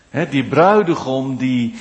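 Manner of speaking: ordinary talk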